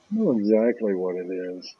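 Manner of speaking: low, soft knowing aside; hushed imitation of someone thinking it privately; mock private knowing. Sitter's unspoken thought, acted.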